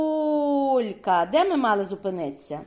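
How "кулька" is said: КУЛЬКА stretched long on КУУЛЬ; calling the word out. Shown as a demonstration. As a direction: call out КУЛЬКА as if hailing it, first syllable drawn out. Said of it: "Поклич". (протяжно кличе: «КУУЛЬка»)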